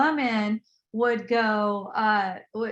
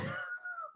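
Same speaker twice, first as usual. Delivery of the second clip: high, thin burst of laughter; laughing; 15 dB quieter than usual. Real, not acted. Amused by imitating her angry face, high laugh. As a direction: laugh helplessly at your own impression.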